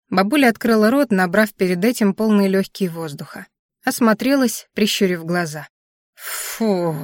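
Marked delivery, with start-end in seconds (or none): 6.1-7.0 long breathy "phew"; noisy exhale. A narrator's reading. Grandmother letting out held breath, noisy exhale. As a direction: blow the air out audibly. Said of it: "выдохнула она шумно".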